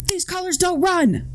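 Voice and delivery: hammed-up patriotic slogan; mock patriot. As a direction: ham up a flag-waving patriot.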